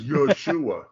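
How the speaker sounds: Yeshua said yo-SHOO-ah; common mispronunciation. Retold or imitated. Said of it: "every Messianic rabbi always says".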